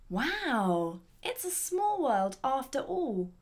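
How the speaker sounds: drawn-out, wondering exclamation; surprised exclamation. Acted. Person bumping into another person, surprised exclamation. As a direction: marvel slowly at a chance meeting. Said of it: "That makes it an exclamation".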